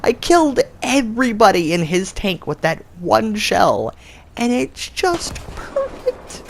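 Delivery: high, giddy laughing delight; gleeful laugh. Real, not acted. Gamer gloating to viewers after a one-shot kill, giddy laughter. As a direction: cackle over the perfect shot.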